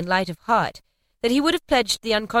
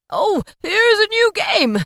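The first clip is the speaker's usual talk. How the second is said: put-on high, slow, pleading old man's plea; eager Scrooge; 14 semitones higher, 7 dB louder, slower than usual. A narrator's reading. Scrooge begging the spirit, high and eager. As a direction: beg like an eager boy. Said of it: "said Scrooge".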